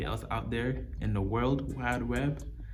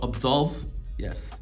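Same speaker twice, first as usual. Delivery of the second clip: usual speaking voice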